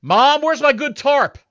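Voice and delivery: high, slow whiny call to mom; yelling across the room. Pretend. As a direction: holler upstairs like a basement kid. Basement dweller yelling to mom.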